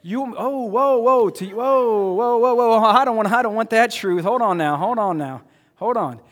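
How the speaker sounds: loud, high whoa whoa, hold on now; flustered protest as God's truth arrives; panicked backpedaling. Retold or imitated. Believer to God, loud panicked whoa. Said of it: "you're like".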